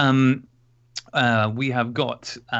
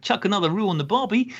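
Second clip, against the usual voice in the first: hammed-up Aussie accent, higher; bad Australian accent; 8 semitones higher than usual. Host jokes to his Australian listener in a corny Australian accent. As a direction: play the stock Aussie tourist line, pitched up. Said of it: "to use that wonderful accent I've been saving up".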